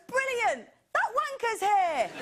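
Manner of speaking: high, loud fake delight; mock cheer. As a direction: cheer it, then shout no. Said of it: "it's not like everyone goes".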